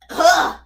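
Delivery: loud high frustrated ugh; frustrated groan. Real, not acted. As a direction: groan out in annoyance. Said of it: "Oh my god".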